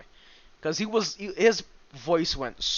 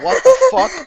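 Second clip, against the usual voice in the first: high, loud disbelieving shriek; shocked shriek; 11 dB louder than usual. Retold or imitated. Reacting to the beaten man's high voice with a high shriek. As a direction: squeal it in shock. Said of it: "And I was like".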